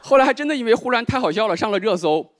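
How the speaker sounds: normal talking voice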